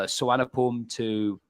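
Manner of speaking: normal talking voice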